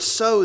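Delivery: "so" said high and loud on its own; stressed word. Shown as a demonstration. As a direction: set "so" apart and punch it. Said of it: "And that word".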